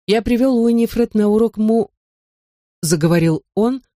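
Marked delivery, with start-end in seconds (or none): 0.1-1.9 cut off mid word. Acted. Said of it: "оборвал его на полуслове".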